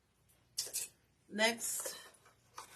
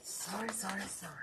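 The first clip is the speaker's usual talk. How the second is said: whispered sorries; 3 semitones higher, 7 dB quieter than usual. Real, not acted. Apologizing quickly under breath.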